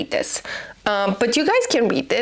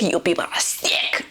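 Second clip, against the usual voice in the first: lower, soft, half-whispered disgust; disgusted hush; 6 semitones lower, 3 dB quieter than usual. Real, not acted. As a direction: mutter it with contempt. Condemning readers of the book.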